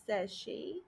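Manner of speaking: ordinary delivery, nothing marked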